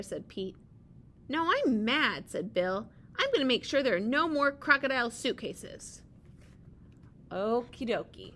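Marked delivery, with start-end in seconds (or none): 1.3-2.3 angry. Acted. Bill refuses angrily in story. 3.2-6.0 determined. Acted. Bill vows angrily in story.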